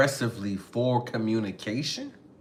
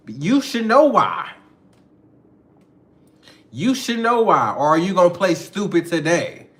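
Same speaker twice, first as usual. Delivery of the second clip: loud acted confrontation; accusing; 5 dB louder than usual. Girlfriend confronting partner for playing stupid, accusing. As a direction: press the accusation hard and loud.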